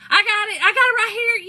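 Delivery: high, loud call of I got it; imitating her yelling to Tommy; mock holler. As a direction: shout it back across the aisle. Voicing the woman answering Tommy.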